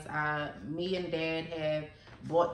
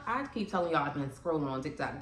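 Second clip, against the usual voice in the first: usual speaking voice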